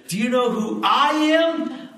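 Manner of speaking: a feisty lady scolding the governor; sassy church lady. Retold or imitated. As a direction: fire it back at him, indignant and high.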